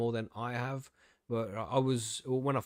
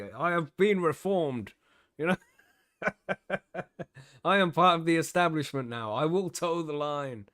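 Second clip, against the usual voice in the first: mock reformed; 5 semitones higher, 5 dB louder than usual. Host tongue-in-cheek to the platform that flagged him, mock reformed. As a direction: declare it stiffly, as if reciting for the censors.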